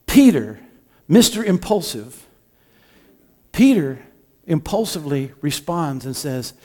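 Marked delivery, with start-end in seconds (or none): none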